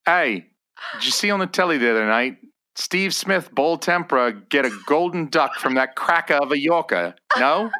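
thick Australian. Acted. Host chatting cricket with his mate, thick Australian. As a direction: gab about cricket in a thick Australian accent. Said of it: "thickest, most unintelligible Australian".

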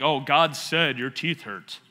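slow, hammed-up holy pronouncement; mock prophet. Shown as a demonstration. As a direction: intone it like a self-important faith healer. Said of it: "You don't have to be like".

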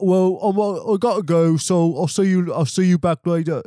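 a guest who won't leave, played up high and loud; indignant Brit. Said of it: "It's like".

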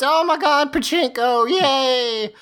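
high, giddy mock squeal; wild unhinged ranting. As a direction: squeal like a kid hooked on a game. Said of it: "and then goes".

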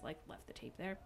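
ordinary talk, nothing marked

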